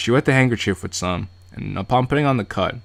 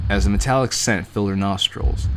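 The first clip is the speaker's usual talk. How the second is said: ordinary talk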